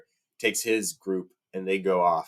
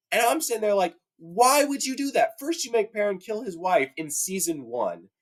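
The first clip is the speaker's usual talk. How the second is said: high-pitched, worked-up rant; fired up; 9 semitones higher than usual. Real, not acted. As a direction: plead your grievance as an outraged book fan. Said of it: "I'm really fired up about this".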